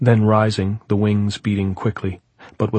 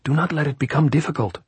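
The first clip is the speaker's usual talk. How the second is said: urging; 7 semitones higher than usual. A narrator's reading. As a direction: press it in a raised pitch.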